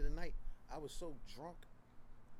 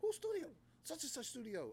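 high incredulous; 12 semitones higher than usual. Retold or imitated. Rapid quoted dialogue.

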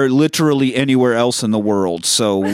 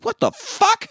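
very high-pitched exasperated yelp; mock outrage; slower than usual. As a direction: blurt it out, squeaking in comic disbelief. Acted actor's outraged reaction to news.

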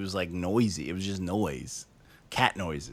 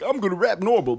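much higher mock rapper voice; 10 semitones higher than usual. Reviewer mimicking an artist who sounds like E-40, high imitation. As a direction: imitate the rapper, pitched well up. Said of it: "and that same voice".

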